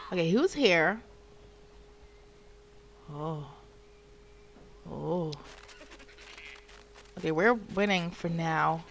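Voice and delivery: usual speaking voice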